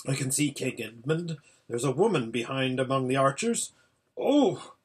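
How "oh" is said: sudden high cry of surprise; startled exclamation. A narrator's reading. Hermit sees something unexpected in battle, startled exclamation. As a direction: gasp it out, pitched well up.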